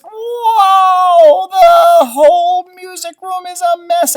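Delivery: loud, high gasp of dismay; shocked. Acted. Teacher showing kids the wrecked music room, shocked. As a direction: react like walking into chaos.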